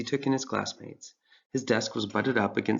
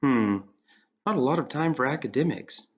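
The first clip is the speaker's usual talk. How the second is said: to himself; 4 semitones higher than usual. Acted. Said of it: "Ryan said to himself".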